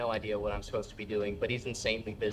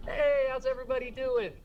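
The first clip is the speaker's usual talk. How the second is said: high, louder hosting call; host hype; 12 semitones higher, 4 dB louder than usual. Retold or imitated. Host greets an empty room, upbeat. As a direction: pump up the room.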